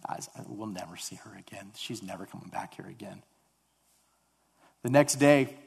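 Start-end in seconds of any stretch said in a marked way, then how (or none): 0.1-3.2 retold resigned aside, much softer; quiet resignation. Speaker recalls telling his companion about the girl, quiet resignation. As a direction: say it low-key and hushed, as if giving up.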